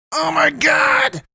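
very high, drawn-out cry of exasperation; exasperated outburst. A narrator's reading. Poe fed up with Damien's joking, exasperated cry. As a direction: wail it out slowly at the top of the voice.

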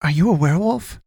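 high-pitched wondering question, rising at the end. A narrator's reading. Boy to the wounded man, wondering. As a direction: ask it high, voice lifting in wonder. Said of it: "Kalen wondered".

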